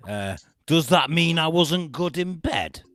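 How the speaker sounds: loud, slow Liverpudlian twang; Cheshire accent. Acted. Voicing the jilted reality star, mock Cheshire accent. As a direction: stretch it out in a hammed-up Cheshire accent. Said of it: "I was gonna say a bit Liverpudlian".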